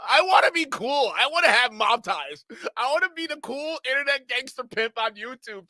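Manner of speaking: high-pitched, hammed-up pleading to join the crew; mock begging. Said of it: "you can see how much he was begging".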